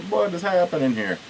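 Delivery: high, puzzled quote; retold bafflement. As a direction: imitate a bewildered reaction, pitch lifted. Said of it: "it was like".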